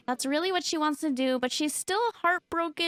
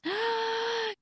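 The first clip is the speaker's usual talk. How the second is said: sharp gasp; 10 semitones higher than usual. Real, not acted. About to announce vampirism.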